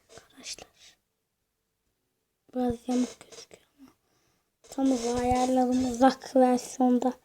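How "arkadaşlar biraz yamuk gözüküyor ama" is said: hushed whisper to the viewers. Real, not acted. Whispering to friends about the crooked drawing. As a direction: breathe it out under the voice.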